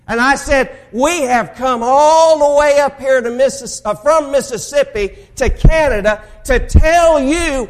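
preaching. Acted. Preacher recounting his answer to the cursing man, booming. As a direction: proclaim it to the whole church.

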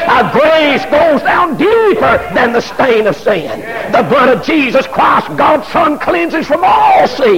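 slow, shouted preaching climax; full-force preaching. Acted. Preacher shouting to congregation about grace. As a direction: drive the grace line home, slowly.

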